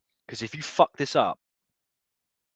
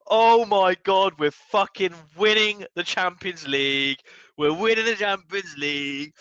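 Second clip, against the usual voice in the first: higher, breathless elated disbelief; giddy excitement; 8 semitones higher, 3 dB louder than usual. Real, not acted. Fan telling chat his team is winning, giddy. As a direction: still buzzing, can't believe it. Just after goal.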